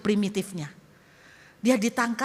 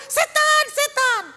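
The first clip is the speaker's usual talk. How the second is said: high, very loud shriek of "Setan"; terrified shout; 8 dB louder, slower than usual. Acted. Tribesman screams at the stranger pulling out his teeth, shrieking. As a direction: scream it like you saw a ghost. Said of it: "dia panggil kepala sukunya".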